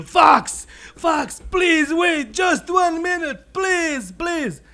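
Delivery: high, drawn-out desperate shout; loud, high-pitched begging; urgent pleading. Acted. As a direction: call out and beg at the top of your voice. Rabbit running downhill, pleading to Fox.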